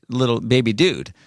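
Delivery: ordinary talk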